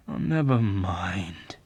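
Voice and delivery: whispered, slow, giving up; collapsing voice. Acted. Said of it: "answered in a collapsing voice".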